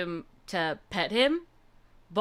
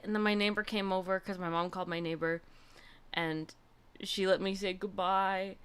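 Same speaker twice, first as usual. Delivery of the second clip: ordinary delivery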